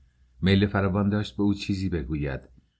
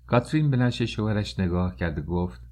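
ordinary delivery

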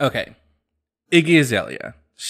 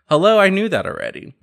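higher and louder, a breezy imitation of mom; mom's offhand reply; 9 semitones higher, 6 dB louder than usual. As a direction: play the mom who already knew it all, lightly. Mom's reply in coming out story.

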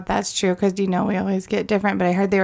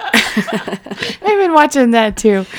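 put-on slow laughing Trailer Park Boys accent; slushy sh for s; 3 semitones higher, much slower than usual. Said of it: "I still can't do the accent".

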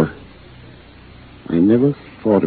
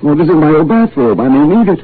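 higher-pitched plaintive objection; put-upon, henpecked protest; 6 semitones higher, 4 dB louder than usual. Acted. Protests to his bossy wife.

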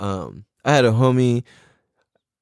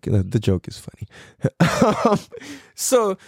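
ordinary talk, nothing marked

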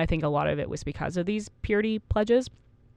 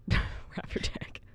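slow whispered giggle; laughing whisper; 6 dB quieter, much slower than usual. Real, not acted. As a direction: breathe out Wrap your dick while laughing. Repeating partner's joke, laughing.

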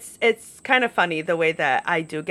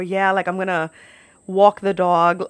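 New York accent. Shown as a demonstration. Showing how words come out around friends, New York accent. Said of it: "I don't really talk like that".

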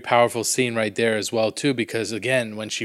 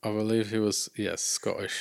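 accidental Scottish accent; 2 semitones lower, 8 dB quieter than usual. Real, not acted. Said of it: "I came off with an accent".

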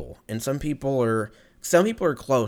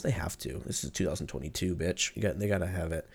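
ordinary talk

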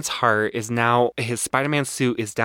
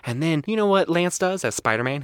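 usual speaking voice

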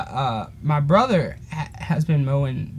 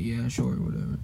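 sulky; 6 semitones lower, 7 dB quieter than usual. Retold or imitated. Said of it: "And I was mad".

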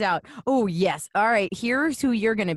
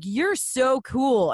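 slow, higher retold compliment; admiring; 4 semitones higher, much slower than usual. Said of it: "they were like".

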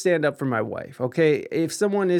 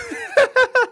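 high burst of laughter; 4 dB louder than usual. Real, not acted. Laughing at own story.

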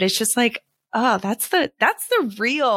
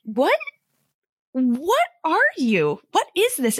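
baffled questions climbing higher; mock bafflement; 7 semitones higher than usual. Viewer reacting to the cousin's accent, mock baffled. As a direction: act out a viewer's disbelief, pitch rising.

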